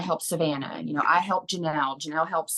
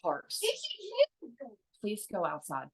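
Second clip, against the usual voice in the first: hushed order; 5 semitones higher, 8 dB quieter than usual. Real, not acted. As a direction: mom softly telling her son to go outside, hushed. Mom sending kid outside.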